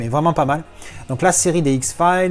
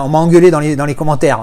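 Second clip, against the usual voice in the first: usual speaking voice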